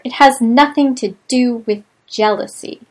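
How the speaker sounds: hissed. A narrator's reading. May answering Lee in anger, hissed. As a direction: spit the words at him. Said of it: "she hissed at him".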